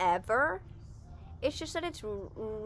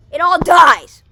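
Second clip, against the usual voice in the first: sudden high, very loud outburst; dramatic shout; 8 semitones higher, 24 dB louder, slower than usual. Acted. Storyteller telling of the grass dying, shouting. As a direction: shout it as a shocking twist. Dramatic twist in the bunny fable.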